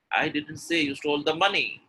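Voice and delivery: final weight on "money"; stress on money. Shown as a demonstration. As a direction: build to "money". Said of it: "And the last one".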